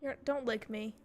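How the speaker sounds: ordinary talk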